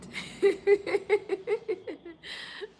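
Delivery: loud high-pitched laughter. Real, not acted. Vlogger laughing at herself for dressing Indian. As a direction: laugh out with delight.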